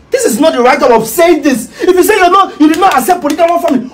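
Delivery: loud, high exasperated retort; heated protest. Acted. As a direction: protest loudly, pitched well up. Raised-voice protest in a quarrel.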